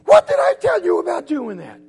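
high, loud mock shriek of outrage; hammed-up furious mom; screaming mother. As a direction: scold at the top of your lungs. Mother scolds her son.

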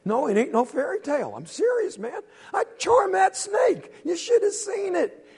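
put-on high, loud, bragging kid; insistent boast. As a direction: insist you charmed it, voice up high. Henry insists to Quentin.

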